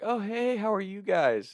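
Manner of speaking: high-pitched imitation of a woman acting casual; suspiciously innocent greeting. Suspect greets friends at her door, falsely innocent. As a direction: put on an overly high, fake-friendly hello. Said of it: "immediately just very suspicious".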